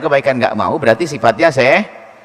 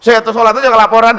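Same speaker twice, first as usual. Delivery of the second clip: higher, loud sarcastic jab; mocking scoffer; 8 semitones higher, 8 dB louder than usual. A scoffer mocks someone for reporting prayers, sarcastic. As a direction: sneer out the mockery.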